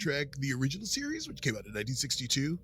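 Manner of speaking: lightly voiced pedantic nerd. Shown as a demonstration. Trekkie correcting people on the original series, hint of nerd voice. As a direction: slide from cool bro into know-it-all fan. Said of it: "you're starting to sound like the guy".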